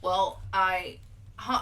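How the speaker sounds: ordinary talk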